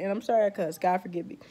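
usual speaking voice

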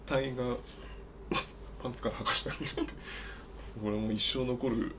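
quiet breathy giggling through the words; trying to read while choking back laughter; stifled giggles. Real, not acted. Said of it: "笑い声が。".

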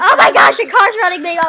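loud laughing oh my gosh; laughing shout. Real, not acted. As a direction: shriek it through laughter. Game car runs her over.

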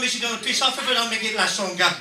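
ordinary talk

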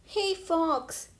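mild storybook cry; calling out. A narrator's reading. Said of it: "they cried".

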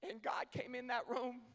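ordinary talk, nothing marked

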